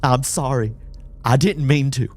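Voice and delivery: high, loud, distressed pleading; panicked apology. Acted.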